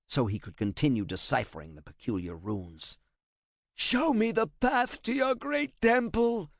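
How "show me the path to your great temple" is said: high, slow, pained cry; cry of pain and fright. A narrator's reading. Translator crying out as Kratos grips his hair, pained high cry. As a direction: wring each word out in agony. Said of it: "he cried out in pain and fright".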